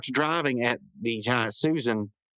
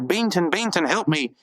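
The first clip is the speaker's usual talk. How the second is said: higher, louder begging; a hammed-up cry for help; mock panicked plea; 6 semitones higher, 6 dB louder than usual. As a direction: boss in a giant's grip begging Benson, mock panic. Mailer grabbed, begging Benson.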